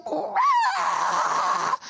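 high, loud imitated wail; hammed-up scream; mock wail. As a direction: cry out like a panicked patient. Amy screaming on the plane.

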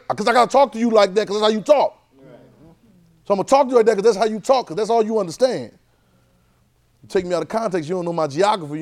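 loud, high, hammering sermon delivery; fired-up preaching; preaching at full force. Acted. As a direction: preach it hard to the church. Preacher insists on context.

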